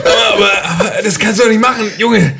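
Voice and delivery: high, very fast stammering panic; frantic scolding. Acted. Father scolding the son about the hearse, frantic and fast. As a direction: sputter in mock panic.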